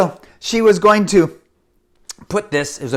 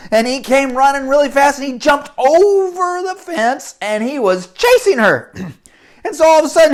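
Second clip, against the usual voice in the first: ordinary delivery, nothing marked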